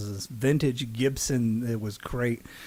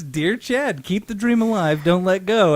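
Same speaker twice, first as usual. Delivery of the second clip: hammed-up quoted sign-off; 6 semitones higher, 4 dB louder than usual. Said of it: "it was always".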